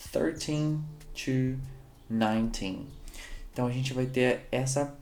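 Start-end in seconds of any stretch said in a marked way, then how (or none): 0.1-2.9 put-on very slow "thirteen to nineteen", teen drawn out; teacher slows down to show the teen; stressed teen. Shown as a demonstration. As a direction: stretch the teen so learners hear it. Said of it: "A gente tem os teens".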